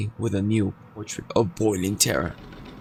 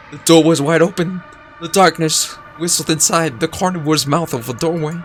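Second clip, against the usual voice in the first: loud, higher, gripping; peak dread; 5 semitones higher, 6 dB louder than usual. A narrator's reading. Narrator at the climax of the night's terror. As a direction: land the horror of the open door. Door opens, peak of fear.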